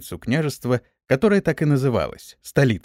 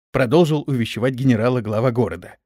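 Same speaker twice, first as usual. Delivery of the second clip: usual speaking voice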